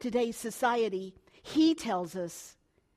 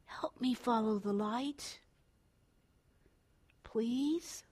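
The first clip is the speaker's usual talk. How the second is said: put-on child begging softly; 5 dB quieter, slower than usual. Child begging, whispered please.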